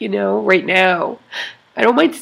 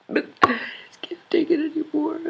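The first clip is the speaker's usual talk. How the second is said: barely voiced, breaking into a whisper as she holds back tears; a real upset, choked whisper; real tearful whisper; 7 semitones higher, 6 dB quieter than usual. Real, not acted. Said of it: "I'm so upset".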